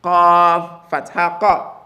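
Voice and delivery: slow, each syllable set apart; slow drill with a clean break between qaf and fatha; slow separated spelling. Shown as a demonstration. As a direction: keep the two F sounds clearly separate. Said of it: "separate both of them".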